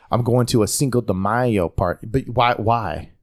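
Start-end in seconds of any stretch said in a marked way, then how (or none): 0.1-2.1 put-on Spanish-accented "Cinco de Mayo" inside plain English. Host imitating someone code-switching, Spanish accent on Cinco de Mayo. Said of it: "why do you put an accent on?".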